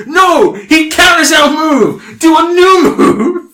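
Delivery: loud, high, drawn-out warning cry; panicked future self. Acted. Future self interrupts his own finishing move, shouting. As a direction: shout a frantic stop from the future. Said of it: "himself from the future comes in".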